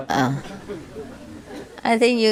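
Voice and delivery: usual speaking voice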